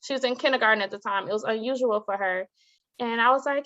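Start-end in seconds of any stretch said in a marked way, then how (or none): none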